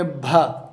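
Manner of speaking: بھا with a breathy aspirated b; aspirated bha. Shown as a demonstration. Teacher modelling بھا for students. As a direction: say بھا with a puff of air after the b. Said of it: "اس کی آواز بھا نکلے گی".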